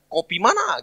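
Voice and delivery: high-pitched Papuan mimicry; clipped Papuan speech. Shown as a demonstration. Papuan asking where you are going, high imitated accent. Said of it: "Jadi singkat".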